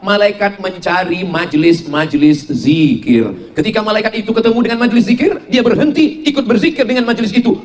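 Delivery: ordinary talk, nothing marked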